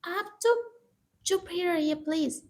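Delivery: loud, high, snapping order to shut up; sharp hush. Acted. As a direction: cut the aunt off hard. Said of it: "Monika कहती".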